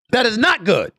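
high, emphatic condemnation; emphatic verdict. Real, not acted. Repeats verdict, raised then dropped.

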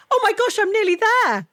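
high, gushing relief; excited relief. Retold or imitated. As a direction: squeal it like the finish is near. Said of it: "you'd be like".

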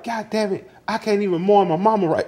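high-pitched retold exasperation; exasperated outburst. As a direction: imitate a fed-up, grieving mother. Voices mother in an argument.

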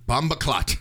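fairly loud, Jamaican slang exclamation; loud patois call. Shown as a demonstration. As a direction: call out Bomba clot with some force. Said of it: "Say this as loud as you can".

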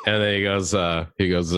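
usual speaking voice

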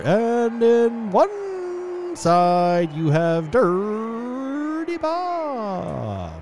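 high, loud ringside call, names stretched out; arena announcer. Acted. Announcer introducing the first fighter to the arena, booming and drawn out. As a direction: draw out the fighter's name like a boxing announcer. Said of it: "from the announcers you hear".

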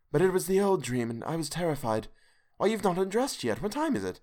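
put-on high, shaken young wife still trembling; a scared wife calming herself; frightened wife. Hester voiced in story.

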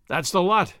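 usual speaking voice

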